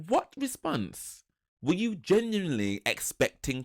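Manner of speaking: high, slow mock indignation; retold indignant challenge. Woman to returning partner, acted indignant challenge. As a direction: challenge him, drawn out and high.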